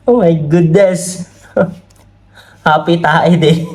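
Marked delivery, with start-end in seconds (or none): 0.1-1.6 laughing. Real, not acted. Laughing at the comments.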